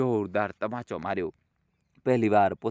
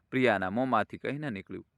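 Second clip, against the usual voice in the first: usual speaking voice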